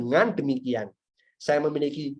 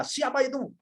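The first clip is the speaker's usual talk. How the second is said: high-pitched imitation of an angry dad; sharply raised mock-father voice; startled father's demand; 9 semitones higher, 3 dB quieter than usual. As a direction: call it out like a father catching someone. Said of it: "Langsung papa saya".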